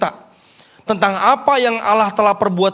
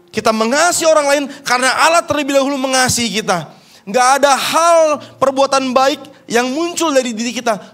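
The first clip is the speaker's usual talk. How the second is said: louder, higher, driving sermon climax; forceful preaching; 5 semitones higher, 4 dB louder than usual. Acted. Preacher exhorting the church, full-force preaching. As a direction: hammer each line home to the congregation.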